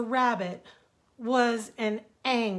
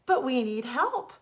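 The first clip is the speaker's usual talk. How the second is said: louder, higher plea; pleading cry; 4 semitones higher, 6 dB louder than usual. Acted. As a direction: beg once more. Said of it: "cried the rabbit again".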